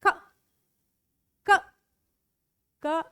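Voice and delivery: "क" as a short hard k; letter sound. Shown as a demonstration. Teacher sounding out letter C for pupils. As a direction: snap out "क" crisp and short. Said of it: "C का sound".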